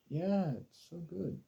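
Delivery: slow, high, hammed-up fake praise; fake enthusiasm. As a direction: oversell it so it's obviously insincere. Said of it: "I was like".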